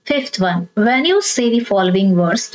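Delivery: ordinary talk, nothing marked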